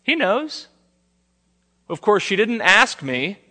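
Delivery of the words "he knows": offhand, pitch jumping way up; mock guy answer. Husband brushing off his wife, mock offhand. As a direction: toss it off like it's obvious. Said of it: "What's the guy answer?".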